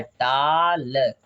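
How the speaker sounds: very slow "टाल", long aa then a separate l; demonstrated final-l sound. Shown as a demonstration. Teacher repeats sound for pupils, "टाल" stretched very slowly. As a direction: draw out "टाल" until the l lands clearly. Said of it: "कौन सा उच्चारण आ रहा है".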